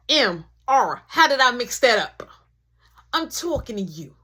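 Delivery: ordinary delivery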